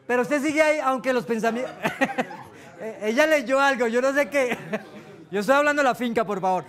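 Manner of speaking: words breaking into laughter; can't hold back the giggles; laughing while talking. Real, not acted. Laughing at someone's reading. (hablando entre risas)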